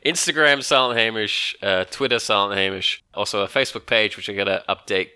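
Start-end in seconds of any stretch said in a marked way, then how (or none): none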